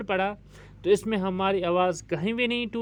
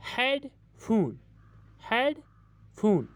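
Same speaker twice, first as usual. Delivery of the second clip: Headphone slowly split as हेड, long pause, फोन; syllable break; 4 semitones higher, much slower than usual. Shown as a demonstration. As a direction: separate the two halves of headphone. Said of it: "इसमें दो syllable हैं". (Headphone को धीरे, तोड़कर हेड... फोन)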